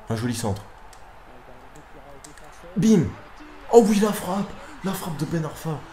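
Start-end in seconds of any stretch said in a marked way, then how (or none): 2.8-5.8 explosion de joie aiguë et forte, finissant en rire; cri de but. Real, not acted. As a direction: exulter sur la frappe. Il marque avec une frappe. (cri de joie)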